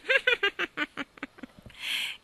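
high, long laughing peal; hearty high laugh; hearty cat laugh. Acted. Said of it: "Il gatto rise di cuore".

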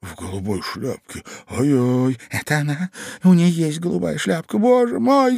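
rising to a high, loud cry; alarmed cry. A narrator's reading. Ivan recognizing her blue hat, crying out alarmed. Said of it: "закричал Иван Андреевич".

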